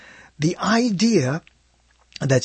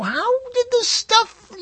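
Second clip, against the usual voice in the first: high, slow amazed gasp of a question; stunned viewer; slower than usual. Retold or imitated. Host mimicking a documentary viewer, high and amazed. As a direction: play a viewer floored by what he just learned. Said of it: "you watch that and you go".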